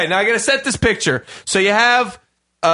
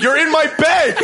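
laughing; 7 semitones higher than usual. Retold or imitated. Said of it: "I'm like".

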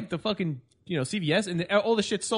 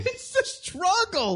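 mock crying; 12 semitones higher, slower than usual. Mocking own allergy suffering.

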